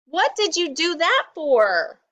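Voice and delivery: high, indignant complaint; crying out. A narrator's reading. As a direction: protest after being tripped. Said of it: "cried Rabbit".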